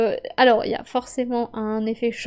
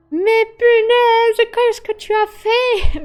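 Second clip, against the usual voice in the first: very high, loud, drawn-out cry of disbelief; exasperated outburst; 10 semitones higher, 9 dB louder, much slower than usual. Retold or imitated. To the colleague who played an odd card, a slow loud exasperated wail. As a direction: wail at the blunder, stretching every word slowly. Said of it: "t'es en mode".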